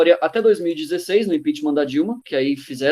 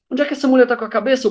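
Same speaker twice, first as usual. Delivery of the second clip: quick, higher-pitched indignant question; imitated public outrage; 6 semitones higher, faster than usual. People asking about the president, mock indignation. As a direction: voice the fed-up public, fast and high. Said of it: "todo mundo ficou puto".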